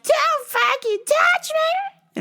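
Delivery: very high, slow squealing shriek; shrill mock shriek. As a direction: screech it like a woman heard through the doors. Heard her through elevator.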